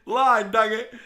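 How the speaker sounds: loud, high giggly frustration; laughing at yourself, losing it. Real, not acted. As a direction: still cracking up. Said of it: "I couldn't keep a straight".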